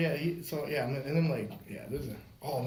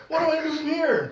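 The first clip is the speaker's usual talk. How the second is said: much higher, louder plea for help; exasperated appeal; 14 semitones higher, 8 dB louder than usual. Retold or imitated. Fireman holding man down, asking cop, exasperated shout. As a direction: call out helplessly for instructions.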